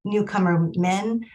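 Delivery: ordinary talk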